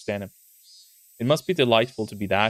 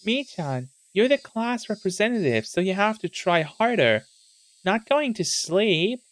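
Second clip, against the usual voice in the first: higher, nagging teacher voice; scolding teacher; 8 semitones higher than usual. Acted. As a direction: chide the lazy student. Voicing teacher scolding Mion.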